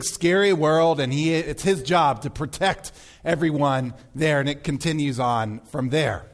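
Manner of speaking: ordinary delivery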